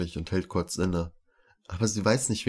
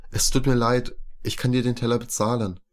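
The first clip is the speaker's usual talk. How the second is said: louder, higher hurried apology; hectic; 5 semitones higher, 5 dB louder than usual. A narrator's reading. Rema apologizes for breaking the plate, hectic. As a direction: blurt out the apology in alarm. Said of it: "sagt hektisch".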